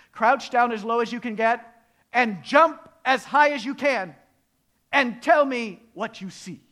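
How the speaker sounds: loud, high, emphatic orders; commanding frog. Acted. Frog tells little mouse to jump, loud command. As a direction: call out each instruction forcefully.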